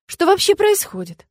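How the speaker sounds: rising alarmed question, pitch jumps high; anxious heroine suddenly realising danger; worried. A narrator's reading. Nastya panics as they plan to tie her. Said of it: "заволновалась я".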